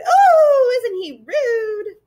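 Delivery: very high squeal, dragged out; delighted scream. A narrator's reading. Gaboon mocking a boy to its friends, screaming. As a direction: squeal it in mock shock, loud on the first word. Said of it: "he screamed".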